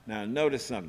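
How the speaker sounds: normal talking voice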